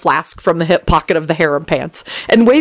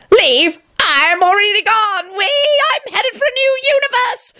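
very high, shrieking glee; joyful shriek. A narrator's reading. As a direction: shriek for joy. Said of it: "John shrieked joyfully".

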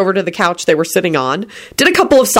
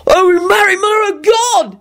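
shocked shout. A narrator's reading. Victor seeing the wolf in the room, shouting. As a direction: cry out in sudden shock. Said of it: "he shouted and sprinted in front".

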